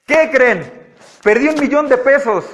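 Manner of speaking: playful fake confession; mock news. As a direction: pretend to drop big news. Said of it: "Si yo les digo".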